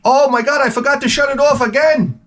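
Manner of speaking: high, frantic mock Italian cry; Italian accent. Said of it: "he was Italian".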